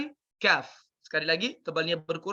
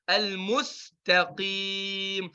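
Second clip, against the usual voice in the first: slow chanted Al-mustaqim; Quran recitation; much slower than usual. Shown as a demonstration. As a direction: recite slowly. Recites the verse.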